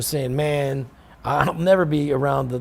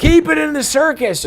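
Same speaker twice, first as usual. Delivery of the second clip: high, loud shout-out; yelled line; 14 semitones higher, 8 dB louder than usual. Retold or imitated. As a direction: call it out from the back row. Yelling out lines in studio.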